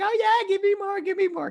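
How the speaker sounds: very high, squeaky begging; mock eager fan. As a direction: beg greedily in a hammed-up high voice. Imitating eager fans of show.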